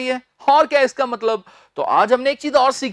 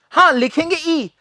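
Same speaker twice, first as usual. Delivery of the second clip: normal talking voice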